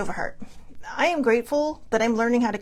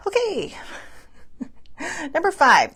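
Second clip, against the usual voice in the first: ordinary talk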